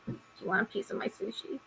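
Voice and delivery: hushed, near-whispered imitation of a child; son's gentle offer. Son comforting his crying mother, hushed imitated child voice. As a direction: offer it very softly, as a kid would.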